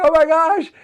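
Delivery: high, loud retold cry of alarm; alarmed exclamation. Driver retelling his car bottoming out, alarmed exclamation.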